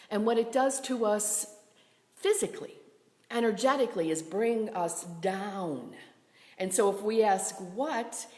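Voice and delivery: normal talking voice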